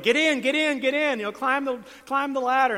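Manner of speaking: high, loud rescue call; megaphone shout. Acted. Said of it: "they're shouting from the megaphone going".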